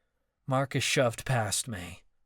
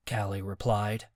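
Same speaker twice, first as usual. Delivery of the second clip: ordinary delivery, nothing marked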